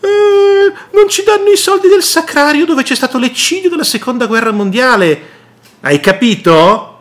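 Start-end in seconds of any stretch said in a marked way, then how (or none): 0.0-5.2 much higher, fast whining voice; whiny complaint. Retold or imitated. Mayor complaining to the regional president, high fast mock whine. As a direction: whine like a wheedling child asking for money. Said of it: "va a lamentarsi col presidente della regione".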